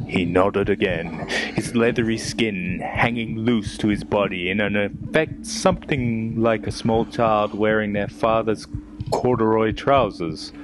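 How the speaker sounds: ordinary talk, nothing marked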